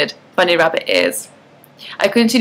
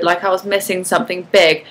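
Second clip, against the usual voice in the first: usual speaking voice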